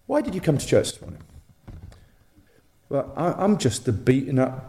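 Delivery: normal talking voice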